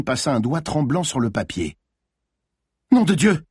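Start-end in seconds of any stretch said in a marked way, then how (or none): 2.9-3.4 high, loud outcry of alarm; shocked cry. A narrator's reading. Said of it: "cria Malines".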